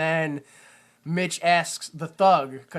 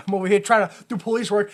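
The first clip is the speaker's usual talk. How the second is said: ordinary delivery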